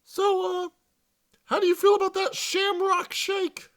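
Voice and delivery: put-on high girly voice. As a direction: chirp it like a teen girl. Girl asks about shakes.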